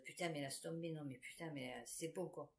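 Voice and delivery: exasperated under-breath swearing; muttered. Real, not acted. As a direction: grumble it to yourself.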